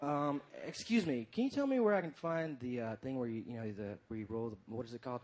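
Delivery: low, hushed, halting question; soft, fumbling inquiry; hesitant customer. Acted. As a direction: trail through it quietly, searching for the word. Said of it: "I'll do my impression of me".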